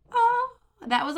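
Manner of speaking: a high surprised oh, then back to normal. Real, not acted. Remembering the book's biggest twist, a high surprised oh. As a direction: jump up on the oh as the twist hits.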